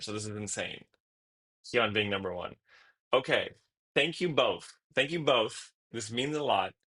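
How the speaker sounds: usual speaking voice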